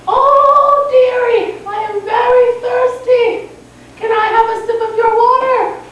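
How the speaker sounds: put-on high-pitched, drawn-out old lady voice; little old lady. Old lady asking a girl for water, high character voice. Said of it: "a little old lady came by".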